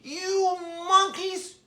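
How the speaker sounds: high, long-drawn storybook call without volume; mock angry shout. A narrator's reading. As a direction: stretch it out as an exasperated peddler. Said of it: "he stomped both his feet and shouted".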